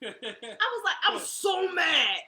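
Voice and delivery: loud laughing mock outrage; laughing mad. Real, not acted. Mom laughing loudly about being mad at her son. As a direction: laugh out how mad you were.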